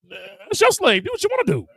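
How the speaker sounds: high, offhand dismissal; mock Abraham. Abraham tells Sarah to do as she likes, offhand. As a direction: brush her off with a shrug. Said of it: "What, what Abraham say".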